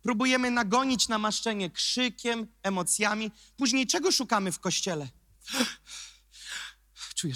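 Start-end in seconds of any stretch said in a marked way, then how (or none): 6.1-7.3 mock breathy rapture. Mocks person feeling the Spirit.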